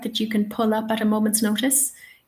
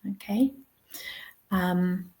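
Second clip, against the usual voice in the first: ordinary delivery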